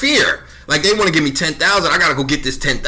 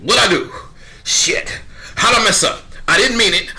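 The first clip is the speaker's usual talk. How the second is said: nervous high excuse; 8 semitones higher than usual. Retold or imitated. The new guy answering the executives, nervous and high. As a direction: scramble for excuses like you're in trouble. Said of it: "I'm like".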